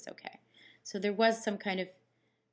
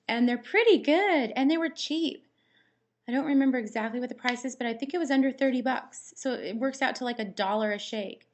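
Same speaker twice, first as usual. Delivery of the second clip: excited; 4 semitones higher, 7 dB louder than usual. Real, not acted. Raving about tasty cheap shakes.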